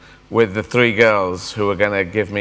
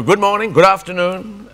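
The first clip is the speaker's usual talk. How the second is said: cheery dad greeting; 8 semitones higher than usual. Retold or imitated. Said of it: "Dad would go".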